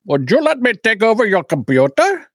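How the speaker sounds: a hint of a polite helpdesk voice; tech support guy. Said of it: "and then he goes".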